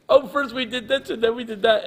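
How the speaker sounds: put-on high, loud excited child's rattle; high-pitched, breathless-rush imitation of a child; fast-talking little girl. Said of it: "she talks so fast".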